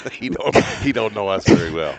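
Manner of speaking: loud laughing remark. Real, not acted. Laughing at the absent judge.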